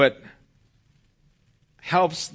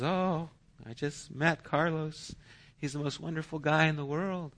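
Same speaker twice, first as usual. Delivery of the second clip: put-on a light, higher, softer smitten-teen voice; dreamy daughter; 6 semitones higher, 5 dB quieter than usual. Daughter gushing to her parent, soft and high. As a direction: lightly voice a starry-eyed daughter.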